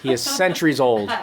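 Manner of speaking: ordinary talk, nothing marked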